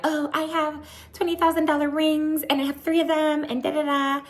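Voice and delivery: high, held-up monotone mock voice; mocking Whitney's impression. Retelling Whitney's impression of Lisa bragging, high mock voice. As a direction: rattle it off in a high put-on voice. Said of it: "Whitney does her impression".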